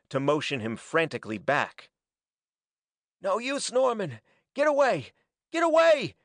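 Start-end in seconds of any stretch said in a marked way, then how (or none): 3.2-6.1 high, frantic cry building in force; frenzied cry. A narrator's reading. Hackett urges Norman to flee, frenzied cry. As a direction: cry out desperately, pitched far up and climbing. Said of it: "he cried hoarsely, frenziedly".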